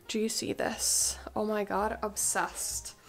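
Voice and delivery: hushed unvoiced awe; whisper. Real, not acted. Whispering to viewers about the Chanel jacket. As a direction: murmur it like it's precious. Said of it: "I feel like I have to whisper".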